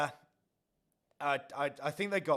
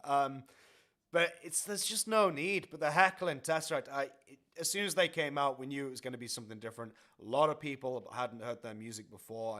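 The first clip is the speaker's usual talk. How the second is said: ordinary delivery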